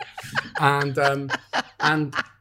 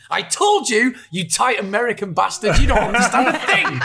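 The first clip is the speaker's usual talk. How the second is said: louder, higher, laughing gloat; mock triumphant yell; 8 semitones higher, 7 dB louder than usual. Turning to his partner in the lobby, a laughing gloating yell. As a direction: crow it, much higher and louder.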